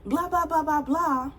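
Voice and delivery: high-pitched mock rant; imitating her ranting at him; shrill-high retold tirade; going off. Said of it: "Just going off".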